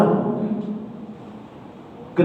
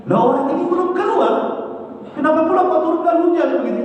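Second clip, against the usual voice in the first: much higher, baffled questioning; puzzled surprise; 9 semitones higher than usual. A narrator's reading. Moses questioning God about the rain, higher baffled surprise. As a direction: ask it like you can't believe it. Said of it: "Musa makin heran lagi".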